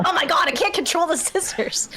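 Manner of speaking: high, flustered infomercial struggle; mock-panicked infomercial demo; infomercial actor. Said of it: "the infomercials where they're cutting".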